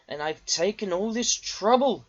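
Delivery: almost shriek it; near shriek. A narrator's reading. Diesel realizing Duck tricked him, near shriek. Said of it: "he almost shrieked".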